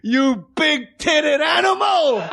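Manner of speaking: slow, high barking yell; hammed-up crude heckle; mock shout. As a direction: bellow it at the front row. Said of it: "he goes".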